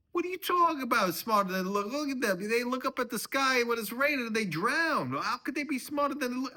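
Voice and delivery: high, loud screaming tirade; furious rant. Acted. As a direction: rant at the top of your voice. Father-in-law rants in quarrel.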